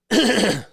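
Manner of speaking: quick loud throat clear. Real, not acted.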